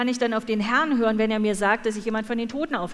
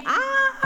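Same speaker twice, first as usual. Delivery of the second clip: echoed scream. Retold or imitated. Interpreter echoes the injured man's cry, high pained scream. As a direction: scream it back for the listeners. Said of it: "Und er hat geschrien".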